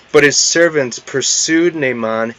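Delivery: usual speaking voice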